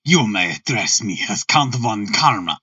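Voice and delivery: put-on deep, formal German-accented voice. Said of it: "I have to do a German voice".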